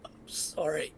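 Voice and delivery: high breathy whisper; a dying last apology. Acted. Dying squire's last words.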